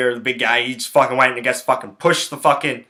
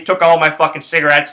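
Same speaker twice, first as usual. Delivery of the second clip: ordinary delivery, nothing marked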